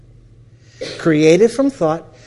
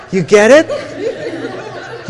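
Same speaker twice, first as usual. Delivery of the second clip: slow, laughing through the words; laughing question; 12 semitones higher, 5 dB louder, much slower than usual. Real, not acted. Speaker asking the audience while still laughing. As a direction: laugh it out as you ask. Laughing at his own story.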